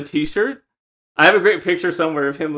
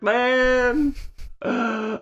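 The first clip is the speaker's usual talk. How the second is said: put-on high, loud drunken sob; drunk crying; 8 semitones higher, 6 dB louder, slower than usual. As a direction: plead while crying drunk. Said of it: "he was like".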